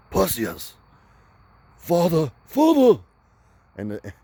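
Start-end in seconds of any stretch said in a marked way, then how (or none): 0.1-3.0 put-on high, loud movie-Greek in an English accent; mock English film accent. Mocking actors in Wrath of the Titans, hammed-up English accent. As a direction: call out in a hammy English accent. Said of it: "They don't talk like".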